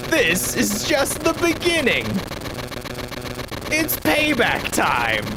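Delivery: hammy villain voice. Acted. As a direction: gloat like a cartoon villain. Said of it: "took on a whole personality".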